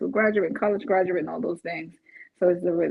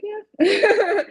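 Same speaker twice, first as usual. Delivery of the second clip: proud yeah; 13 semitones higher, 7 dB louder than usual. Retold or imitated. Proud reply to lender.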